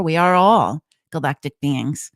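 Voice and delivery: usual speaking voice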